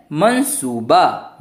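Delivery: मनसूबा stretched out slowly syllable by syllable; slow careful pronunciation. Shown as a demonstration. Teacher modelling slowly for students: मनसूबा, मन-सू-बा. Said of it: "माँ के ऊपर बिंदु".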